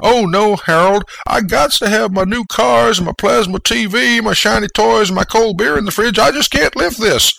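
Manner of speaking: over-the-top whiny cry, way up in pitch; mock crying out. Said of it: "And you cry out".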